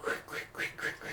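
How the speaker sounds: soft whispered rapid repeats; hushed, choppy imitation of quick turns; whispered swish effect. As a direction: whisper it like a board flicking side to side. Mimics quick carving turns.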